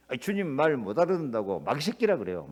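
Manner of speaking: usual speaking voice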